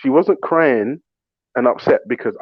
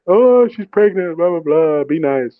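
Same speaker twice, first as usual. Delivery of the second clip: nagging mother-in-law, high at first; high, loud nag tailing down to normal; mock yapping; 6 semitones higher, 4 dB louder, slower than usual. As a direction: imitate a mother-in-law going on and on. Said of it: "yapping in my ear".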